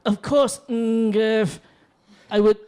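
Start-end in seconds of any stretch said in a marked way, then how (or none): 0.7-1.6 Gurf leaned on and drawn out, falling; stress on Gurf. Acted. Accepting the stranger's invitation, stressing Gurf. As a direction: give Gurf a heavy emphasis. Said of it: "emphasis on the Gurf".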